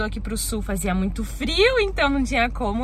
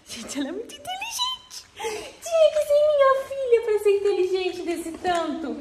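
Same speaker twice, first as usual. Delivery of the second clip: laughing; 12 semitones higher than usual. Real, not acted. Proud laughing about daughter.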